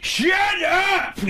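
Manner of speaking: high, loud Sandler yell; sudden high-pitched shouted Sandler outburst. Retold or imitated. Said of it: "he yells".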